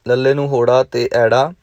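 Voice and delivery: ordinary talk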